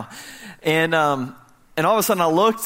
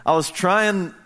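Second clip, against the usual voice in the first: usual speaking voice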